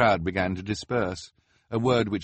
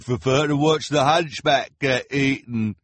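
high, slow gawking crowd voice; a gleeful onlooker, pitched up; high spectator voice; 9 semitones higher, 6 dB louder, slower than usual. A narrator's reading. Carnival spectator speaks.

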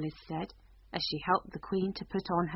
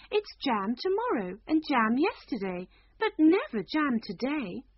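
higher, louder queen laying down the rule; a queen reciting nonsense as plain fact; 7 semitones higher, 4 dB louder than usual. Acted. Queen explains jam rule.

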